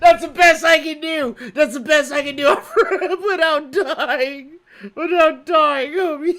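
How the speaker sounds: high words through laughter; laughing. Real, not acted. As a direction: speak while laughing hard. Laughing at failed impression.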